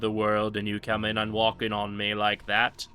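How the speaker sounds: mock British villain voice; a hint of a haughty British villain; lightly put-on British villain voice; British accent. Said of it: "my accent turned British there for a moment".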